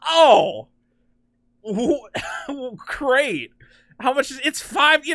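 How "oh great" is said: higher, drawn-out sarcastic reaction; sarcastic cheer with a laugh; mock enthusiasm. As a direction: feign delight while cracking up. Mock reply to awful pitch.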